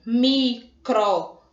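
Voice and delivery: "microfone" drawn out slowly, syllable by syllable; split into syllables. Shown as a demonstration. Teacher splitting a word for the class, slow and deliberate. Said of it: "Na separação silábica".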